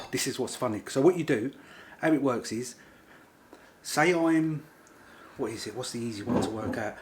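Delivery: ordinary talk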